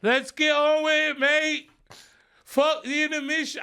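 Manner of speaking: loud, high put-on English accent, slowed down; mock English accent. Fed-up heckler hurrying the show along, loud mock English accent. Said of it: "I don't know how to do the English accent".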